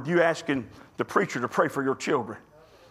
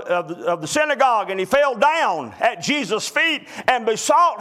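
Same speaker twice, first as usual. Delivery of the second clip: fervent preaching; 3 semitones higher than usual. Acted. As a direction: read the verse like a call from the pulpit. Preacher reading and expounding scripture.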